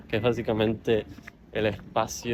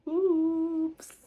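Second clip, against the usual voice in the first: mock squeaky oops. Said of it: "Y yo tipo".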